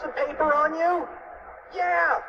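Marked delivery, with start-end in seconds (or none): 1.7-2.2 high cartoon yelp; mock scream. A narrator's reading. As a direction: yelp it high without full force. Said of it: "screamed Cheeseball".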